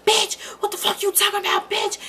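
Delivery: high, fast mock yell of the abuser; loud high imitated rage; imitating his screaming. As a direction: play him screaming at her. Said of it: "and scream".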